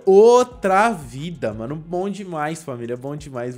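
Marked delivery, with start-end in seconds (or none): 0.1-1.5 very loud, slowly stretched exclamation; empolgado. Real, not acted. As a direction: savour each syllable at full volume. Celebrating a big improvement in game. (exclamação bem alta, lenta e empolgada)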